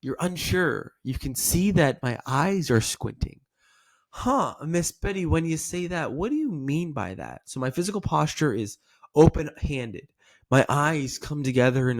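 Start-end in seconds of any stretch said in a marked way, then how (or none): 4.1-7.4 curious. Shown as a demonstration. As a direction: lean in, puzzled and inquisitive. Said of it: "Your curious tonality is when you're gonna lean in".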